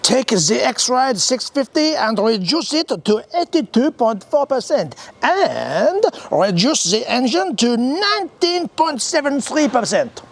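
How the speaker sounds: high, slow mock-French accent; hammed-up French boss giving orders, pitched high; mock French shout. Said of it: "shouted at them".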